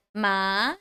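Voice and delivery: MA rising in pitch; second tone. Shown as a demonstration. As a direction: let "ma" climb upward. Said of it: "So your pitch grow higher".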